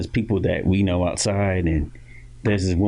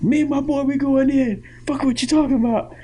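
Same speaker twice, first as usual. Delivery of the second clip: dad at the club door. Retold or imitated. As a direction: push past the bouncer with bravado. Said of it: "he's like".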